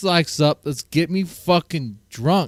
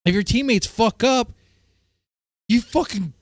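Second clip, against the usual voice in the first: ordinary delivery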